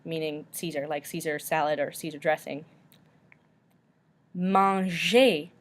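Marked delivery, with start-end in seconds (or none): 4.3-5.5 Manger as French mahn-ZHAY; silent final R. Shown as a demonstration. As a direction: say Manger ending in an AY, no R. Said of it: "we do not pronounce the R".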